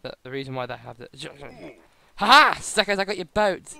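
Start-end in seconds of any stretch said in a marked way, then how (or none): 2.1-3.7 loud crowing laugh; gloating. Acted. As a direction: crow over the theft. Gloating over stolen boat.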